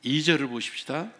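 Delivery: ordinary delivery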